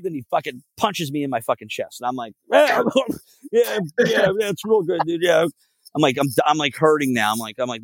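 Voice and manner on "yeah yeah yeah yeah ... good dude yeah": high, strained mock agreement; pained fake approval. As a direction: imitate agreeing through the pain of a punch. Feigned approval after chest punch.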